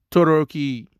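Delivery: usual speaking voice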